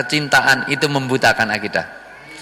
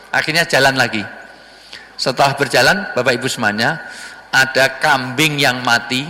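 ordinary talk

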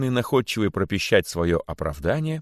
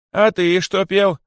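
loud, high, slow demand; stern royal questioning; 11 semitones higher, 6 dB louder, slower than usual. A narrator's reading. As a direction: interrogate him like a king. King interrogates the accused rabbit. (строгий королевский допрос)

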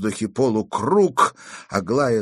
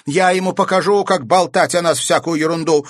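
high, loud angry threat; 9 semitones higher, 7 dB louder than usual. A narrator's reading. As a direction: declare it crossly, voice raised. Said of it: "рассерженно заявила она". (рассерженно, на повышенных тонах)

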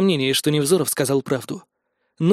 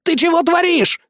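very high, panicked exclamation; shocked protest; 12 semitones higher than usual. Acted. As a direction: demand an answer in high-pitched shock. Karl cries out after gunshot. (потрясённый крик высоким голосом)

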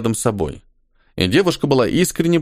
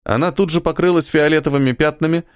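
usual speaking voice

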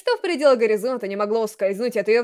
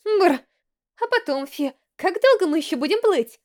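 shivering; 6 semitones higher than usual. A narrator's reading. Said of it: "Дрожа от холода".

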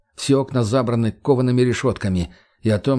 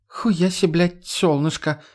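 crooked smirk; 6 semitones higher, slower than usual. Acted. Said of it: "криво ухмыльнулась Марго".

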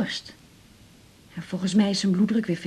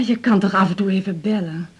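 normal talking voice

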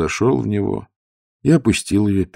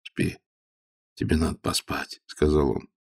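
tender; 3 semitones lower, 5 dB quieter than usual. A narrator's reading. Father soothes sick daughter.

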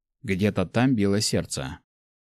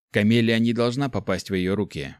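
plain reading voice

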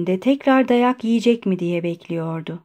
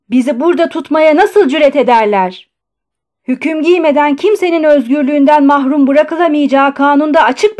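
loud, raised-pitch outraged tirade; indignant shouting; 5 semitones higher, 7 dB louder than usual. A narrator's reading. Patient jumping up to protest his confinement, shouting indignantly. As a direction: shout in outrage, building higher. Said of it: "yerinden fırlayarak bağırdı".

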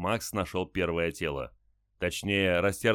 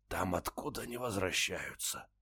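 slow, strained, barely voiced rasp; faint deathbed whisper; 7 dB quieter than usual. A narrator's reading. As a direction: breathe it out like a dying man. Dying soldier's rasp.

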